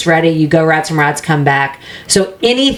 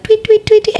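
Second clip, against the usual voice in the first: bird chirp imitation.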